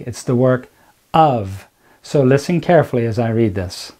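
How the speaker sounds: ordinary talk, nothing marked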